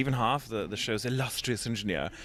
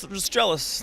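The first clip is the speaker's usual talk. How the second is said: higher, smug "They're just jealous"; mock smug; 9 semitones higher than usual. Shown as a demonstration. Actor smugly dismissing crew who pelt him with candy. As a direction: brush off the throwers with mock pride.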